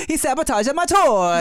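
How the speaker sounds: put-on high, laughing Japanese accent; hammed-up Japanese accent, laughing; mock Japanese accent. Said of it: "my Japanese accent came off kind of Italian".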